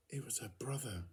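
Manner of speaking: whispered, breath only; a narrator's whisper at the story's turning point; hushed reveal. A narrator's reading. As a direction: breathe it like a secret. Narrator reveals the stone figure.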